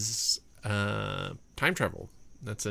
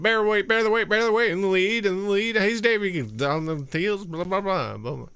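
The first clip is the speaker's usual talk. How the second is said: high, loud, rapid race caller; horse race announcer; 8 semitones higher, 5 dB louder, faster than usual. Retold or imitated. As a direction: rattle off names like a track announcer. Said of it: "Horse race announcer".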